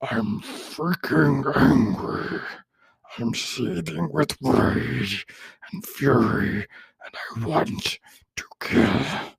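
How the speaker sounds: very slow, high whisper of a monster; second, better take of the monster voice, slow and whispered. Shown as a demonstration. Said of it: "Let me try that one more time".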